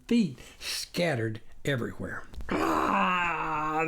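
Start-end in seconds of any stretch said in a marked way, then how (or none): 2.5-3.8 raised startled cry of horror; a mild mock scream. Farmer finding the dead chickens, startled cry. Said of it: "the farmer screamed".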